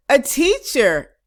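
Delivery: slow, loud chanted answer; loud class shout. Acted. Pupils answering their teacher together, loud and slow. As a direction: belt it out with the whole class. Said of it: "Lily's response was the loudest".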